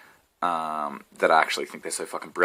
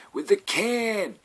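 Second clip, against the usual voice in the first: put-on slow, high old-timer finishing the memory; old man voice again; 9 semitones higher, slower than usual. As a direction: pick the geezer voice back up after the laugh. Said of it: "I sound like a ninety-year-old man".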